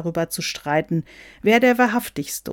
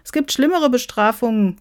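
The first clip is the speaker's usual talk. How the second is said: higher, louder quip; wry interjection; 7 semitones higher, 5 dB louder than usual. Acted. Someone comments in the Santa meeting, wry interjection. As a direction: toss it in, pitched up.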